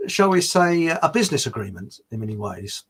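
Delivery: ordinary talk, nothing marked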